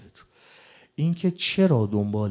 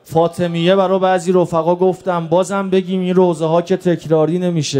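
raised, urgent preaching lament; mourning sermon; 6 semitones higher, 6 dB louder than usual. Real, not acted. Preacher recounting the assault on Ali to mourners, urgent lament. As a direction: press the grief on the congregation in long chanting phrases.

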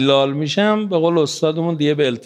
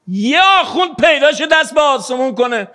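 much higher and louder preaching cry; fervent shout; 10 semitones higher, 3 dB louder than usual. Real, not acted. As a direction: shout it out to the crowd. Preacher building to outburst. (فریاد پرشور منبری)

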